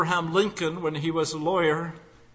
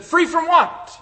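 incredulous; 11 semitones higher, 8 dB louder than usual. Acted. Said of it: "She said".

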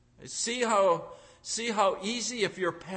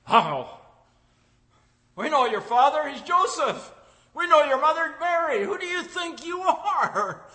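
scoffing crowd; 9 semitones higher, 8 dB louder than usual. Retold or imitated. Crowd voiced scoffing at Jesus.

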